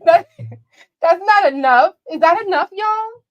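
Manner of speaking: high, loud cry to the audience; loud mock appeal. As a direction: call out to the chat in exaggerated disbelief. Calling out to the live chat.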